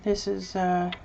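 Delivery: ordinary delivery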